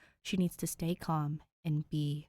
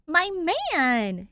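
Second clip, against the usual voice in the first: loud happy crow; Danny greets Dave loudly, crowing; 11 semitones higher, 9 dB louder than usual. A narrator's reading. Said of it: "she crows".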